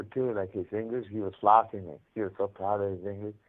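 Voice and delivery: ordinary talk, nothing marked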